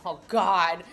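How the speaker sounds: ordinary talk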